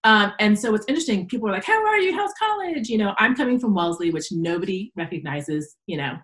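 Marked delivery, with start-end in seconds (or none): 1.7-2.9 bright, much higher mock-friendly greeting; perky classmates. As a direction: chirp it like an eager old classmate. Classmates greeting her at party.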